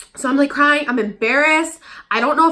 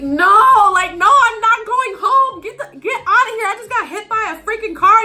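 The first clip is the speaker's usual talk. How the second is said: much higher, louder laughing protest; indignant refusal; 11 semitones higher, 5 dB louder than usual. Retold or imitated. Teen refusing her mom on the phone, loud indignant protest. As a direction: refuse outright, half laughing.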